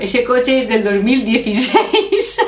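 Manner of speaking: slow, drawn-out amazed mimicry, giggling; imitating astonished Gordon. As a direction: stretch it out in mock wonder, ready to laugh. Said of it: "Y Gordon". (imitando asombro, alargado y entre risas)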